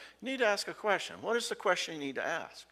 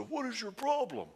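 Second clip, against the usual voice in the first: blaming; 10 semitones higher than usual. Retold or imitated. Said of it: "as opposed to".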